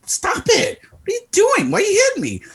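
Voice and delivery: high-pitched yelp of protest; hammed-up, exasperated high-pitched complaint; mock indignant protest. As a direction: squeal it like someone getting smacked. Acting out being hit by friend.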